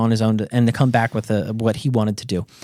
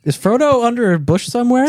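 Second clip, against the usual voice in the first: raised-pitch comic suspicion; loudly thought accusation; 9 semitones higher, 3 dB louder than usual. Retold or imitated. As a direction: probe with a mock-suspicious question. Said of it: "he thought it real loud".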